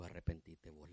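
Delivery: low, soft private rebuke; quiet retort. Acted. Preacher telling the deacon to repent, quiet low retort. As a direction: say it low and hushed to one person. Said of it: "Le digo".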